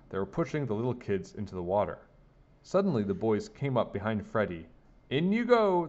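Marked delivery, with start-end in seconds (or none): 5.1-5.9 high, loud gleeful yell. A narrator's reading. Big boys pushing Freddie into the pool, yelling. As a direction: yell it high in one swoop. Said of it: "they yelled".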